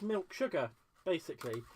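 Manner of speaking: usual speaking voice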